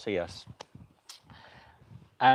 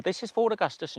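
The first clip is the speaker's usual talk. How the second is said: higher-pitched imitation of a guide; retold friendly officer; 10 semitones higher than usual. Police officer showing walkers her town, higher-pitched imitation.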